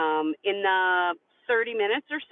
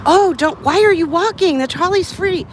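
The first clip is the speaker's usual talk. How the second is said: higher, louder eager voice; eager helpful man; 9 semitones higher, 8 dB louder than usual. Retold or imitated. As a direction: pitch it up like a keen helper. Man eagerly offering trolley tip.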